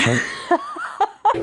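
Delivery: bright embarrassed laugh; short high chuckle. Real, not acted. Laugh after emotional confession.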